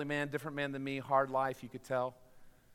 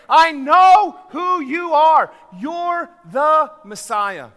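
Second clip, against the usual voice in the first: very loud, high, drawn-out proclamation; slow, ringing cry of faith; triumphant declaration; 12 semitones higher, 21 dB louder, much slower than usual. Acted. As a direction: shout each word slowly to the back row. Climactic declaration of faith to Christ.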